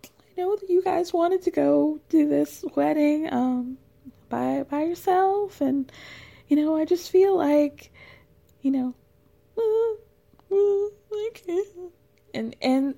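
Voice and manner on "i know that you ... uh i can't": higher, mock weepy pleading voice; mother-in-law fishing for an invitation, mock crying; high, fake-tearful mother. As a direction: play the guilt-tripping mom on the verge of mock tears. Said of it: "Gwen's like".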